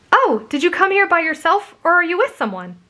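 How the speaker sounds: high, curious friendly voice; bubbly girl. A narrator's reading. Girl asks if he came alone, bubbly. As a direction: ask with perky interest in high pitch. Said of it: "a bubbly voice".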